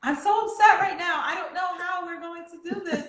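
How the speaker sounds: high, upset mock panic; worked-up replay of panicking; retold panic. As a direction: act out being upset and overwhelmed, pitch well up. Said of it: "I'm, like, panicking".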